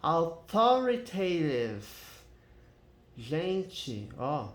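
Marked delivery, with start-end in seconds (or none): 0.0-1.9 Authoritative drawn out slowly, syllable by syllable; pronunciation demo. Shown as a demonstration. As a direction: repeat Authoritative slowly after the dictionary. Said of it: "como que é a pronúncia". (Authoritative devagar, demonstrando a pronúncia)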